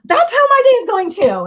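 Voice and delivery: very high, worked-up mock tantrum; matched fit. Parent matching a kid's fit, high and frantic. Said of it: "they are throwing a fit and you're like".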